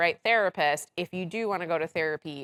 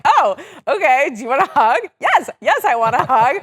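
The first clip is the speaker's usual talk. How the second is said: laughing retelling; 8 semitones higher, 11 dB louder than usual. Retold or imitated. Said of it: "my husband was like".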